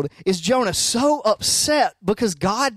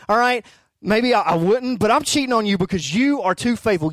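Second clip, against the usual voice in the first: normal talking voice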